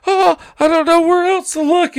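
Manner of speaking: very high mock-frantic voice; hysterical mom. Mom searching for her missing son, high mock hysterics. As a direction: imitate a mother in hysterics. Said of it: "Stewart's mom is in fucking hysterics".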